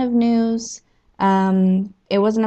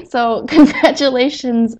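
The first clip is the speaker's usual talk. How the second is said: laughing; 4 semitones higher, 4 dB louder, slower than usual. Real, not acted. Laughing while congratulating.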